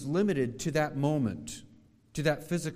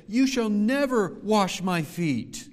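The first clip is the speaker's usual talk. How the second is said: emphatic refusal; 5 semitones higher, 7 dB louder, slower than usual. Acted. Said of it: "Excuse me, I said that wrong".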